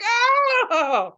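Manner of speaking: very high, loud crying scream; hammed-up wail of pain; mock wail. Teen with frozen hand, wailing in mock pain.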